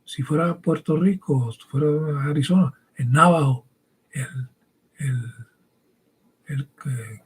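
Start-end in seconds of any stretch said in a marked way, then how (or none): 4.1-7.2 whispered hesitation while searching for a word; whispered thinking aloud; whispered aside. Real, not acted. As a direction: drop to a whisper, hunting for the name.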